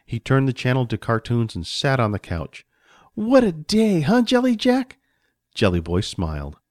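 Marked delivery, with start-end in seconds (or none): 3.1-5.0 put-on high-pitched child voice; happy little boy. Johnny on the couch with his friend, happy. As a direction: chat to your pal like a small boy. Boy chats happily with treat.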